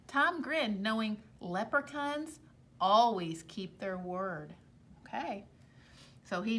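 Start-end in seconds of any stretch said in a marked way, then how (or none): none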